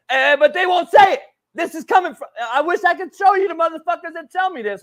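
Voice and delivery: worked-up outburst; heated rant. Real, not acted. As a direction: vent it loud at the people who won't speak up. Ranting about fans who stay silent.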